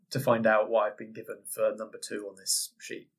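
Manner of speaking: ordinary talk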